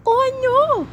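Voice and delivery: high, loud dismayed oh no; alarmed cry. Acted. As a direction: gasp it out in alarm. Letter F cries out.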